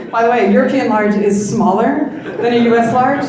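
chuckling through the words about sizes; laughing aside. Real, not acted. Laughing to the audience about shirt sizes. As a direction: laugh it off, amused.

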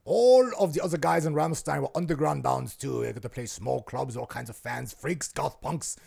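mock German accent telling the band's story. Imitating a Rammstein member telling of his punk band in a German accent. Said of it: "me doing a German accent".

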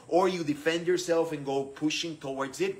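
ordinary talk

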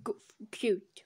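breathy whispered correction. Real, not acted. Narrator stops himself swearing, whispering. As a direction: whisper it to yourself.

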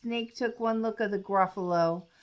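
usual speaking voice

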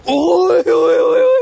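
very high, loud repeated yells; shrieked, high-pitched oy-oy calls; alarmed shouting. Real, not acted. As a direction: holler out in sudden alarm. Crying out amid the surrounding dogs.

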